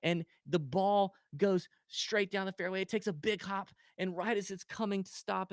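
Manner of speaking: usual speaking voice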